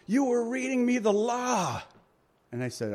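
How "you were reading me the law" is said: man in line. Retold or imitated. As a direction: play the guy behind him calling out. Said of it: "he's saying".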